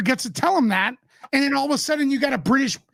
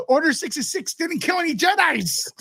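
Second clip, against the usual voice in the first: blurted out through laughter; can't hold the laugh while protesting; real laughing outburst; 5 semitones higher than usual. Real, not acted.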